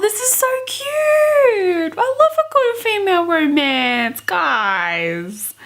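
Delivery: high, slow squealing delight; gushing squeal. Real, not acted. Vlogger gushing to viewers over the kiss, high squeal. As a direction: coo it out, overwhelmed by cuteness.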